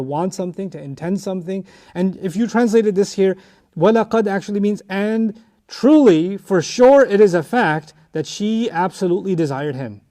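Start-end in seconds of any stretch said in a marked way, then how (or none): 4.9-7.0 TRULY and SURE stretched out slow and loud; emphatic translation. Shown as a demonstration. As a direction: lean hard on "truly" and "sure".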